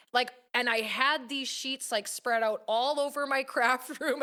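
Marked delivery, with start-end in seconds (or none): none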